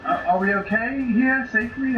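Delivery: ordinary talk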